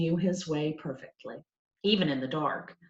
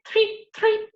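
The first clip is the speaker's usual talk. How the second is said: high chirpy threep threep; mock cricket chirp; 4 dB louder than usual. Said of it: "others say threep".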